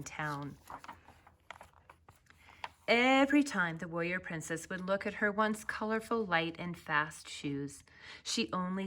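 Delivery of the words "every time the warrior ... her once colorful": ordinary delivery, nothing marked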